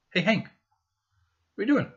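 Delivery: high, sing-song question to a pet; talking to the dog. Real, not acted. Asking the dog Hank.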